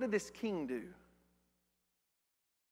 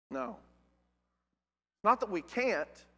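normal talking voice

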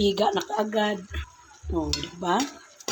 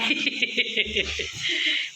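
short bright laugh rising; light laugh; 5 semitones higher, 5 dB quieter than usual. Real, not acted. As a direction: laugh in greeting. Greeting the visitor.

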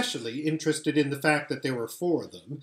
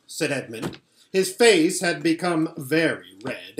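usual speaking voice

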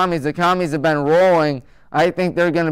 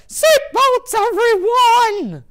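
much higher cheery teacher cry, slow stretched everyone; Miss Frizzle impression; much slower than usual. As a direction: squeal it like a zany cartoon teacher. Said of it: "Russell Wilson as Miss Frizzle and go".

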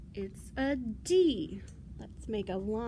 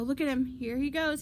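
ordinary delivery, nothing marked

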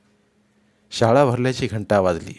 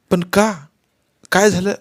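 put-on anxious boy; 9 semitones higher, 5 dB louder than usual. A narrator's reading. Boy anxious, asking why.

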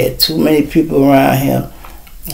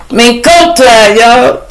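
slow, drawn-out hype; hyped up; 9 semitones higher, 5 dB louder, slower than usual. Real, not acted. Vlogger hyping mink coat season, slow and drawn out. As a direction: stretch it out with swagger.

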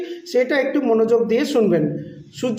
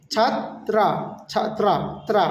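"Chatra" split as chhaat - raa; broken into syllables; 4 dB quieter, slower than usual. Shown as a demonstration. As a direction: gap before the open "ত্রা", then repeat it. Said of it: "এভাবে বলবেন".

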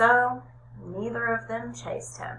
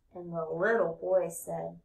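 usual speaking voice

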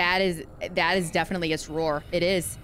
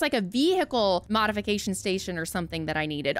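ordinary talk, nothing marked

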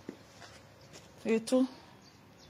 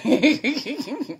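laugh; 3 semitones higher than usual. Real, not acted.

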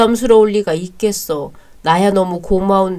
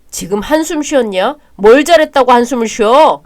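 angry yell; 4 semitones higher, 8 dB louder than usual. Acted. Brother yells at sighing sibling.

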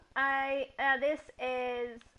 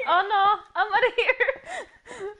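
panicked; 9 semitones higher, 8 dB louder, slower than usual. Real, not acted. Jump scare in horror game.

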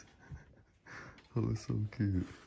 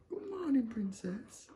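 sing-song greeting; 10 semitones higher than usual. Real, not acted. Greeting the baby on waking.

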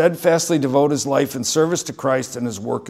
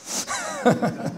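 short airy laugh at his own aside; breathy laugh; 13 semitones higher, 5 dB quieter than usual. Real, not acted. Pastor laughing at his own aside to congregation, airy chuckle. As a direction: let a quick breathy laugh out.

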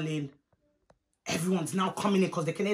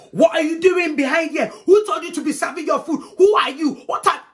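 high, loud outraged challenge; confrontational scolding; 6 semitones higher, 6 dB louder than usual. Retold or imitated. Auntie challenging a girl serving herself food, loud scolding. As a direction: fire off indignant questions, pitched up and loud.

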